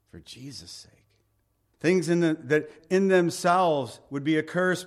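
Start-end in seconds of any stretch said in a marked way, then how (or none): 0.1-0.9 softer echo. Shown as a demonstration. Preacher echoing the phrase to the church, almost hushed. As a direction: repeat "For Jesus' sake" quieter, fading. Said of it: "For the Son of Man's sake".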